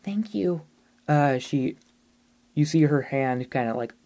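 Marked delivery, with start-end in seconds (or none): 0.0-0.6 put-on high, breathy child's voice; little ghost girl. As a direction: speak as a small, shy ghost girl.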